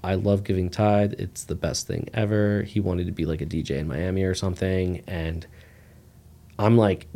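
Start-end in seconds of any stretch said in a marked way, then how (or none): none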